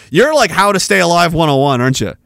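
usual speaking voice

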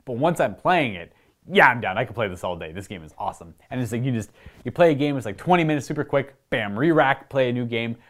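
ordinary talk

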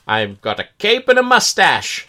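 high, hammed-up villain voice with cackle; sneering panto villain. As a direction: ham it up like a mustache-twirling villain. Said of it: "ham-fisted, melodramatic".

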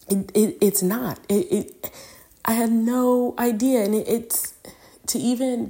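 choked up. Real, not acted. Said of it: "I'm getting emotional about this".

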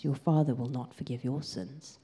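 usual speaking voice

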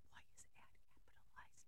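breathy whispered comment to herself; soft puzzled whisper aside. Real, not acted. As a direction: whisper it, half to yourself. Reader's whispered aside on text.

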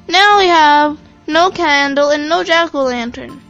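put-on very high, loud child's lament; hammed-up storybook crying; weeping wail. A narrator's reading. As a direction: whine it out tearfully as a little girl. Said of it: "weeping and crying aloud".

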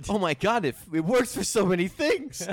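high, loud and thrilled; bursting with excitement; excited. Real, not acted. Excited discovery.

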